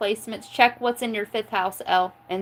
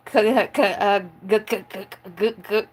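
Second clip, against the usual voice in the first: stammering k and g starts, lightly done; mock stutter. Mimicking a stuttering commenter, lightly played. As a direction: stumble on the first sound playfully. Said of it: "somebody has a stutter".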